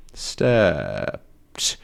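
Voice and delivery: "Stepped" low and stretched out; drawn out. Shown as a demonstration. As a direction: stretch "Stepped" slowly, dropping in pitch. Slow repeat of explained word.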